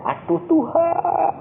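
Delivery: high, drawn-out mock wail; acted-out helpless complaint; whining protest. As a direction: whine it slowly, pleading with God. Retold protest to God about wife.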